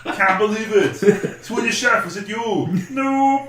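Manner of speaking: hammed-up Arnie voice; Austrian accent. Arnie-style Swedish Chef.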